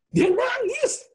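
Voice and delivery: very high, loud mock dismay; dramatic exclamation. Exclaimed repeat for dramatic effect. (seruan dramatis bernada tinggi)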